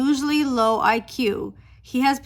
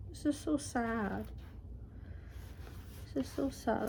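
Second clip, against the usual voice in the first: soft sad; 12 dB quieter than usual. Real, not acted. Soft repeat.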